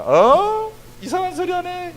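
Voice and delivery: high, startled mock scoff; incredulous. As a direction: sound like they can't believe this talk. Youth group reacts in disbelief.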